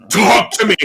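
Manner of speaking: loud, high acted shout; forceful furious command; angry demand. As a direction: yell it at someone who won't answer. Choker demands an answer.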